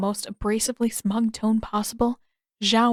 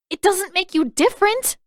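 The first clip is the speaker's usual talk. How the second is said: put-on higher, louder sharp girl voice; girl shooting back; 11 semitones higher, 5 dB louder than usual. A narrator's reading. Girl retorting to Zuko, higher and louder. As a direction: fire the retort back at him. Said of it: "the girl shot back".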